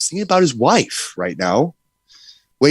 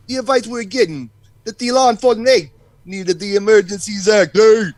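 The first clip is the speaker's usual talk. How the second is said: lightly hammed-up Italian mobster accent, fast; mock Italian accent; 4 semitones higher than usual. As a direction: play a wiseguy reading a statement in a thin Italian accent. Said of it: "I can't do the Italian accent here".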